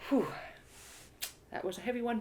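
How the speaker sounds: ordinary delivery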